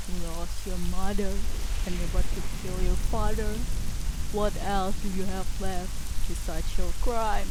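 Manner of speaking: loud, urgent rebuke; Baron's loud reproach. Acted. Baron confronting his children, loud and pressing. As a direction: hammer them with the question. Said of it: "he asked himself, and the shooters both".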